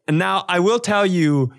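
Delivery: ordinary delivery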